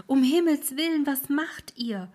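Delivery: high, loud horrified cry; the ferryman cries out in alarm; alarmed old ferryman. Acted. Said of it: "rief der Alte".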